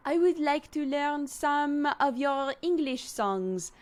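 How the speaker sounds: put-on high, slow French-accented exchange student. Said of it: "Dodger's French accent is really good".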